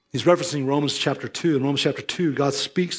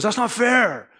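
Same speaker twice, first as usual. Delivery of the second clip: higher, indignant "that's not fair"; mock protest shout; 6 semitones higher, slower than usual. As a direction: call it out like an outraged student. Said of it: "Lavery, shouted out".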